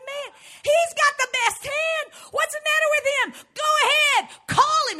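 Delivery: high, loud urging; excited yelling at the TV. Retold or imitated. Woman yelling at the poker player on TV, urging him on. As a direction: shout at the screen, impatient. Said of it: "I said".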